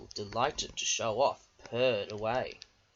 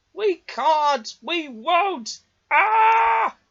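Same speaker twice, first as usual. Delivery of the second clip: very high, loud refusal ending in a cry; refuse, then shriek; defiant trucks crying out; 11 dB louder, much slower than usual. A narrator's reading. Trucks crying out as their brakes break.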